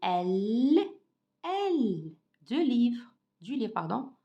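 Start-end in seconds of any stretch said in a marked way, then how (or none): 0.0-2.1 L said alone as a long, held "elll"; consonant demo. Shown as a demonstration. Teacher shows pupils how to pronounce the consonant, L held long and clear. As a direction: draw out the letter name L for learners. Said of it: "Comment je prononce cette consonne".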